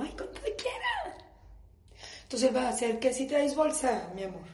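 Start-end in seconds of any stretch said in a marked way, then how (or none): 0.0-1.2 high giggly sweet voice; fake sweet giggle. Shown as a demonstration. Wife faking sweetness to husband, high giggly. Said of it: "Pongan una sonrisa así como".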